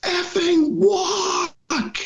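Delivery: high-pitched yelled cry, stretched out slow; desperate yelled answer to the guide on the mountain; retold scream. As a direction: scream it out, long and drawn. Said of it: "I just screamed as loud as I could".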